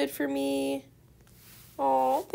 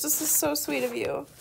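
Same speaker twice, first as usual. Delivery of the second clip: usual speaking voice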